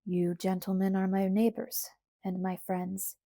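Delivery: usual speaking voice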